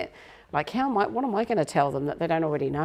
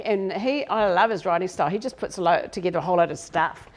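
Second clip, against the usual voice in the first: ordinary delivery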